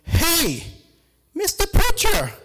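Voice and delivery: loud, high call down a long hall; yelled greeting. Retold or imitated. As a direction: holler to someone far away. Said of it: "And then he yelled".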